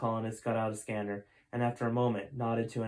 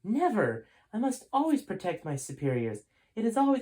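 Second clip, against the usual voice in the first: high, loud assassin protest; high loud loyal refusal; 10 semitones higher, 3 dB louder than usual. Acted. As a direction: cry out high in alarm. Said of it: "turned around sharply".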